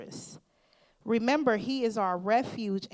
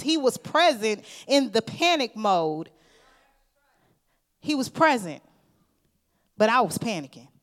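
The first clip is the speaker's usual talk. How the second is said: loud, driving sermon; preaching at full force; 8 dB louder than usual. Acted.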